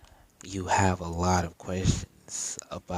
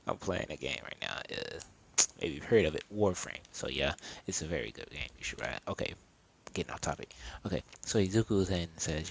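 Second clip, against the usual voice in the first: usual speaking voice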